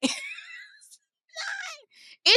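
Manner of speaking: high squealing laugh through the words. Real, not acted. Confessing the AI writes for her, squealing laugh.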